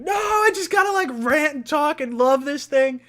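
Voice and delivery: high, mock-frantic self-quote; impatient outburst. Host retelling his impatience to get on air, high outburst. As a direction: burst out high, itching to talk. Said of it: "I'm like".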